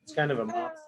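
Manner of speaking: ordinary delivery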